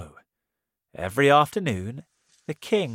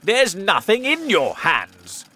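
loud, high, indignant yell; the king yelling at the tailor; angry shout; 8 semitones higher, 9 dB louder than usual. Acted. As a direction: protest loudly in disbelief. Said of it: "shouted the king".

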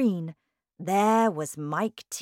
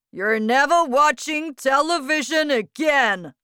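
loud, raised scolding yell; angry father's shout; 8 semitones higher, 9 dB louder than usual. A narrator's reading. Father punishing his son, shouting. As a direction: lay down the law at full voice. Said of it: "shouted to Mike".

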